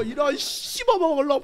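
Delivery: high, drawn-out insult; cursing demo. Shown as a demonstration. Preacher showing how to curse demons, slow cursing. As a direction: grind out the curse slowly. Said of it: "하면서 다 욕하세요".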